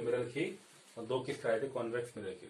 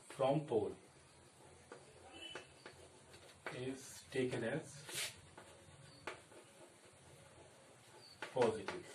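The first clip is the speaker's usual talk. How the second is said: slow note dictation; 9 dB quieter, much slower than usual. Acted. Teacher dictating notes slowly.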